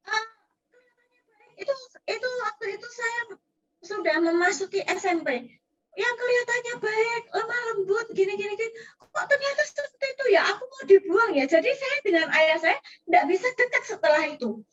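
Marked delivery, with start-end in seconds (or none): 5.9-11.5 hurt cry. Retold or imitated. Child's hurt realisation recalled.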